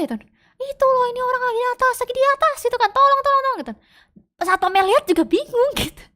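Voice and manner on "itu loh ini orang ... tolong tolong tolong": high, fast panicked call for help; high frantic plea to the security guard; panicked yelling. Retold or imitated. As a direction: scream for help, pointing at the roof. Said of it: "Mika teriak".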